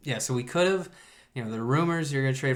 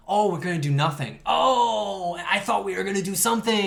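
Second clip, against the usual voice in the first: mock surprise; 6 semitones higher, 4 dB louder than usual. Mock surprise at doing nothing.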